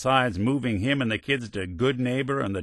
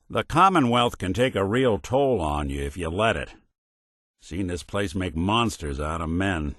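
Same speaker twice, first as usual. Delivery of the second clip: ordinary delivery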